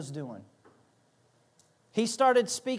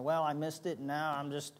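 lower, softer, mopey giving-up voice; imitating a discouraged believer, lower and softer in mock despair; mock self-pity; 5 semitones lower, 6 dB quieter than usual. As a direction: play the defeated believer, half mocking. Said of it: "how you start feeling".

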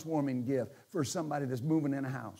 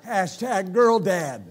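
laughing punchline; 2 semitones higher, 9 dB louder, much slower than usual. Real, not acted. Joking punchline.

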